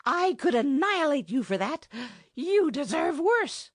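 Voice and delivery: high, loud furious threat. Acted. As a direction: rage at him, voice up high. Furious lady threatens the enchanter.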